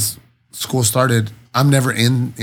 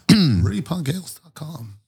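slow, higher booming announcer read; 5 semitones higher, slower than usual. Shown as a demonstration. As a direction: boom it like a stage introduction. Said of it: "So your announcer voice".

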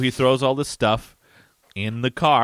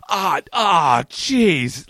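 higher-pitched mock panic; clueless panic; 5 semitones higher, 3 dB louder, much slower than usual. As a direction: imitate flustered gasps at a bomb. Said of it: "he had no idea what to do".

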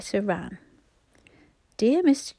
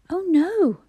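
high, louder startled cry; shocked; 9 semitones higher, 6 dB louder than usual. A narrator's reading. Bobby, horrified they'd rub the muslin, cries out shocked. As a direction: blurt it out, scandalised. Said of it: "greatly shocked".

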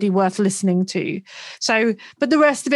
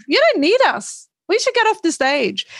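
high-pitched retold remark; 9 semitones higher than usual. Retelling [name]'s reaction.

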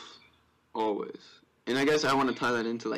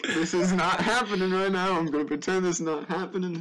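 higher, laughing denial voice; imitating friend in denial; 7 semitones higher, 3 dB louder than usual. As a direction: pretend you can't hear the noise.

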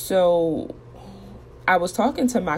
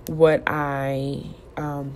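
ordinary delivery

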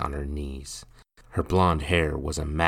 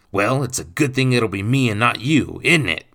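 stepfather's loud, raised snap; barked retort; 7 semitones higher, 9 dB louder than usual. A narrator's reading. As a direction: bark it at the reluctant boy. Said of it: "Nathan barked".

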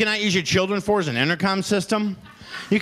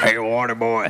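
Billy Bob Thornton impression; 7 semitones lower than usual. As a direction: call the water boy in a Sling Blade voice. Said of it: "Adam Sandler is a water boy".

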